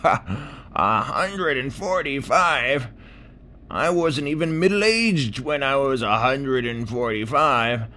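put-on high, laughing boastful old clam; scoff, then boast slowly; talking clam.

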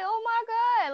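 high, loud gasp of shock; shocked oh my god. Retold or imitated. Watching cars crash in the Houston snow, shocked loud cry. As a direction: cry it out in disbelief. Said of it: "I was like".

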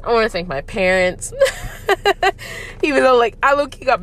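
tearful laugh breaking into a thank-you; laughing through tears. Real, not acted. Podcaster thanking her parents, laughing through tears.

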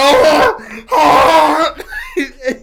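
loud, high, drawn-out cry; mock wail. Refusing to answer the Capital One caller, loud mock wail. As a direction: wail over the caller to drown them out. Imitates noise made at caller.

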